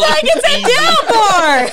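loud, high laughing disbelief. Real, not acted. Laughing at photographer story.

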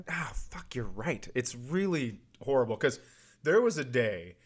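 ordinary talk

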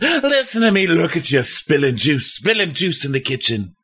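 high, hammed-up honey voice with a laugh; husband mocking his wife's spilt juice, high and syrupy; mock sweet husband. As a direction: play the falsely sweet husband, high and giggly. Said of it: "he's like".

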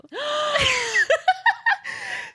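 high, loud laughing burst; a big shrieking laugh at the reveal; loud burst of laughter. Real, not acted. Laughing at strip club reveal.

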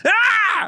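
high-pitched mock scream; retold scream. Man retells yelling at the strangers before he left, mock scream. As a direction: let out a high comic "ah!". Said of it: "And I went".

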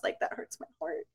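breathless whisper, voice gone; whispered hurt. Retold or imitated. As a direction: whisper it like a wounded confession. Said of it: "And I was like".

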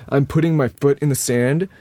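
ordinary talk, nothing marked

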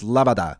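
labada as la-ba-DA, last syllable stressed; Russian stress. Shown as a demonstration. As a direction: land on the final DA of labada. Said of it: "But if you're saying it's".

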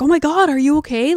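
high, loud, quick burst of concern; mock-panicked check on someone; retold alarm. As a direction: blurt it fast, reliving the worry. Retold alarm at child gagging.

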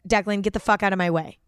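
normal talking voice

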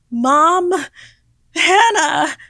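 high, very loud calling out; panicked full-voice shout; scream. A narrator's reading. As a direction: scream for your mother and sister. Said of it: "I screamed out".

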